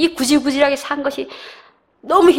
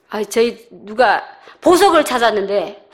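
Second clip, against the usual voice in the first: ordinary talk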